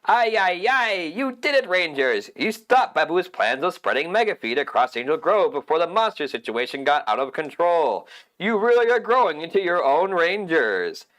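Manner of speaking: high, excited little robot; chirpy robot cheering the team; Alpha Five robot. Acted.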